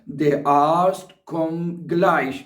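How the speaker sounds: very slow, every German word spelled out for learners; slow dictation. Shown as a demonstration. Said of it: "वो फिर कह सकता है".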